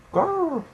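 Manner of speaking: growl. Retold or imitated.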